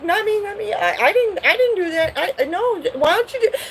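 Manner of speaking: put-on high, pleading kids' denial; kids denying. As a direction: protest your innocence like a guilty child. Siblings deny breaking the gift.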